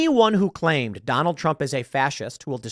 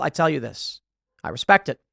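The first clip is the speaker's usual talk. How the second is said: usual speaking voice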